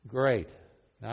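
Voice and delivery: normal talking voice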